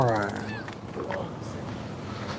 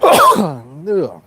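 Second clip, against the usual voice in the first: coughing. Real, not acted. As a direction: cough then disgusted ugh. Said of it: "I just swallowed a bug".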